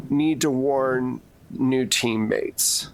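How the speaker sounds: usual speaking voice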